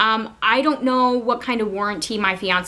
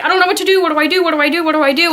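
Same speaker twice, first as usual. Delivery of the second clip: high, frantic repeated question; panicked pleading; 7 semitones higher, 6 dB louder, much faster than usual. Retold or imitated. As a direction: beg for help, looping the same words in panic. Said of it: "And I just kept saying to him".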